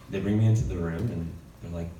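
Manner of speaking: normal talking voice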